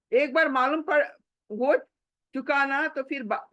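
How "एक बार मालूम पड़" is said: ordinary talk, nothing marked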